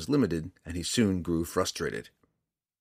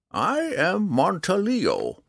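slow, high, louder proud announcement; 13 semitones higher, 5 dB louder, slower than usual. A narrator's reading. Old man introduces himself to the drow, proudly. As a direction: announce your name with pride, drawn out. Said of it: "the old man explained proudly".

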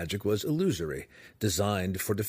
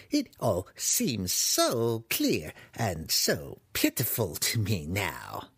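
crazed wizard, musing; 3 semitones higher than usual. Acted. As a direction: work it out aloud with deluded grandeur.

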